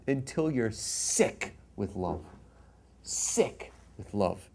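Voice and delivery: hushed, slowed, savouring sick with love; soft and slow. Real, not acted. Rabbi describing lovesickness to students, soft and slow. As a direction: let it linger softly.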